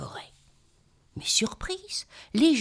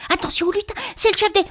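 urgent warning; 11 semitones higher, 6 dB louder, much faster than usual. Acted.

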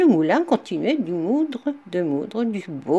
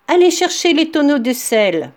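barked order; 5 semitones higher, 5 dB louder than usual. Acted. Captain orders his sailors.

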